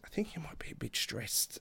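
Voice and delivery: very soft, near-whispered suggestion; retold gentle aside. Imitating a friend telling the sick wrestler, very softly. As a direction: say it very quietly, breaking it gently.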